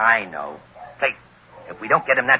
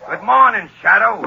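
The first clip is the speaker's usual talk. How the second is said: loud taunt; 7 semitones higher, 5 dB louder than usual. Acted. Gunman taunts the treed Shadow.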